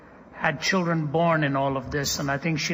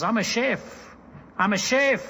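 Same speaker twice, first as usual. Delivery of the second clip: put-on higher-pitched proud declaration in a South African accent; imitated South African accent; 6 semitones higher than usual. Preacher quoting a rescued woman's testimony, imitated South African accent. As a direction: mimic her South African accent, lifted and emphatic. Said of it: "In her own accent, she says".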